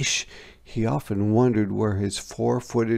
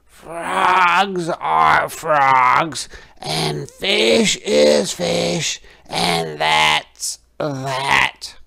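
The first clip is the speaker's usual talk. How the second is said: slow, louder, higher, insistent; stubborn tadpole; 5 semitones higher, 7 dB louder, much slower than usual. A narrator's reading. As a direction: lay down the rule slowly and firmly. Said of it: "the tadpole said".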